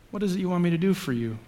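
quiet caring question from God; soft gentle question. Shown as a demonstration. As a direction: offer the question gently to the listener. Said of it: "question on God's heart for you".

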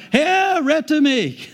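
high-pitched mock cry of a sinking man; acted cry for help. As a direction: call out for rescue, imitating Peter's cry. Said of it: "schrie er und sprach". (gespielter hoher Hilfeschrei)

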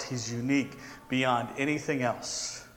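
quiet reverent close; hushed reverence. Real, not acted. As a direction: drop it to a hush. Hushed closing statement.

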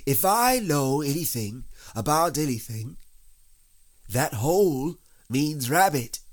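deliberate bear logic; Pooh, pondering bear. A narrator's reading. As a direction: reason it out slowly and proudly. Narrator voices Pooh.

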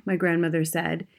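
usual speaking voice

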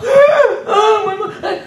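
high squealing mock panic, half laughing.

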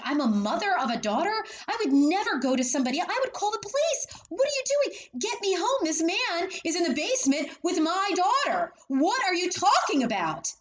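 mother screaming at her friend to drive her home, high and frantic; frantic outburst. Retold or imitated. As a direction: shout it like a mother terrified for her child. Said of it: "I'm like".

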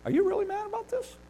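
God gently needling a sulking Jonah, soft and high; God's gentle question. Retold or imitated. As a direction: ask it lightly, almost amused. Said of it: "God just looks at him and says".

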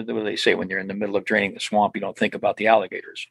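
usual speaking voice

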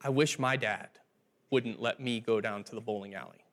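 retold private wish, voiced low; under his breath. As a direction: say it quietly, as if not meant to be heard. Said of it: "say under his breath".

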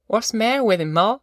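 put-on high curious child voice; child's voice. A narrator's reading.

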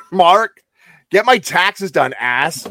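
loud, high-pitched mock telling-off; slow, exasperated pretend rebuke; mock scolding. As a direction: call him out sharply, hammed-up annoyance. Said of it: "And Tiger's like".

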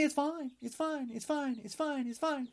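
mock the carefree crowd brushing it off; mocking careless people. Ranting podcaster mimics people who shrug off the virus, mocking sing-song. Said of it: "People go out and they say".

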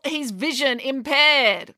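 high, slow, pointed correction; irritated and emphatic; snapped. A narrator's reading. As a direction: correct them crossly, word by word. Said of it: "snapped April".

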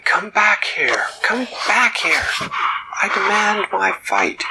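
high, loud, desperate yell; yelling after him. Acted. Dak chases the kraken underwater, high loud yell. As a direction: shout after the fleeing monster.

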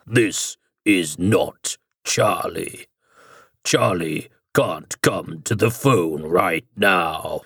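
very slow, low, growling alien voice. Acted. Possessing entity speaks through Charlie to the Prime Minister, slow menacing growl. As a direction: let something else speak through you, slow and low. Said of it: "growling edge to it and a much deeper register".

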